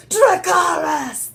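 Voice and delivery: high, drawn-out commanding shout; Dany's dragon command. Acted. Quoting Dany commanding her dragons, slow high shout. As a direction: call it out slowly to the dragons. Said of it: "when Dany says".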